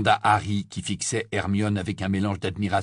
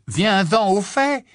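loud, high, impatient cry; exclaiming; 12 semitones higher, 8 dB louder, slower than usual. A narrator's reading. Ron interrupting Hermione's excuses, exclaiming impatiently. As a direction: cut in with exasperated force. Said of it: "s'exclama Ron".

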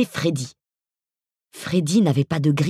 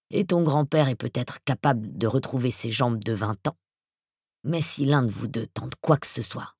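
voice from beyond the grave; 2 semitones lower, 5 dB quieter than usual. Acted. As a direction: speak like a menacing ghoul. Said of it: "sa voix d'outre-tombe".

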